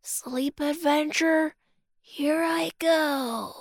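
fading into sleep; sleepy murmur. Acted. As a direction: whisper it off to sleep. Falling asleep.

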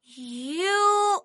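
very slow, each word stretched; drawn-out answer. Acted. Kiki answering Puppy's quiz, slow and drawn out. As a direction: spell out the answer word by word, long vowels.

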